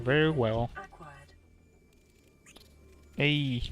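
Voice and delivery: usual speaking voice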